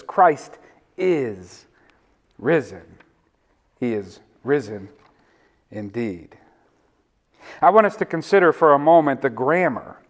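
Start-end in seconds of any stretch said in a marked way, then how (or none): 0.0-3.0 "is" stretched out and set off before "risen"; stress on IS. Shown as a demonstration. Preacher models child's insistence for church, stress on IS. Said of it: "say it with that kind of emphasis". 3.8-6.4 low, deliberate "is", pauses between phrases; stress on IS. Shown as a demonstration. Preacher models the Easter response, stress on IS. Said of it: "say it with that kind of emphasis".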